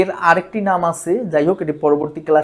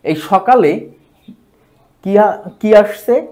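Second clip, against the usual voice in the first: normal talking voice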